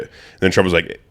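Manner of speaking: normal talking voice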